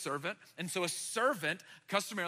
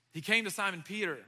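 usual speaking voice

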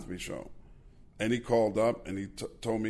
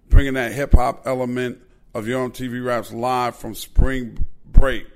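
loud, rising hype roll call; hype announcer; 3 semitones higher, 11 dB louder than usual. Real, not acted. The host shouts out the old guests, loud and hyped. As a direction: build the crowd up like a live show intro.

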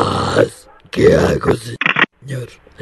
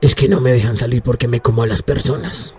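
possessed voice; 6 semitones higher, 3 dB quieter, faster than usual. Acted. El poseído habla.

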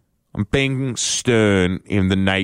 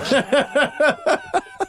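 laughing at own email; high burst of laughter. Real, not acted. Breaks up reading the email.

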